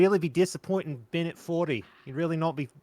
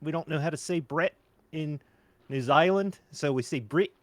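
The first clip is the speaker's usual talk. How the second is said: put-on New Zealand accent, deadpan; lightly done New Zealand accent; Jermaine. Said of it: "I'm Jermaine from [name]".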